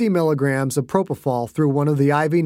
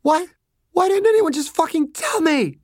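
high, upset outburst; distraught daughter, pitched way up; anguished girl; 13 semitones higher than usual. Acted. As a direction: demand an answer through grief. Grieving girl protests to her doctors.

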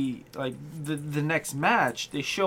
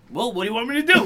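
mock exasperated; 5 semitones higher, 3 dB louder, much faster than usual. Acting out a reaction to Vince.

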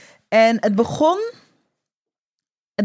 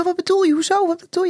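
much higher, alarmed questions; high, startled retold panic; panicky; 9 semitones higher, faster than usual. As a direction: replay a flash of panic. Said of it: "ik raakte een beetje in paniek".

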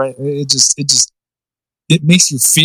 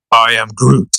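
Groot voice; 3 semitones lower, slower than usual. Shown as a demonstration. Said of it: "I can't do the fucking young Groot voice".

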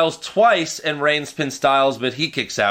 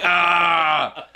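short mock yell, slightly raised pitch; imitated cry of pain; 3 semitones higher than usual. Imitating Ambrose stuck with a tack, mock pained yell. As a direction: let out a mock "Ah!" from a thumbtack jab. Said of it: "and he's like".

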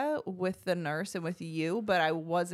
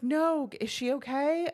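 high, slower pleading question; worried question; 9 semitones higher, 4 dB louder than usual. Retold or imitated. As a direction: beg for news about the baby. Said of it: "And I was like".

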